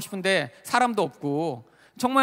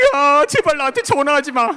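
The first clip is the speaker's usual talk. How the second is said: loud, high tearful begging; crying plea; 6 semitones higher, 8 dB louder than usual. Retold or imitated. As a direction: beg through tears not to be called again. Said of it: "제가 울면서 얘기했어요". (울먹이며 크게 애원하듯)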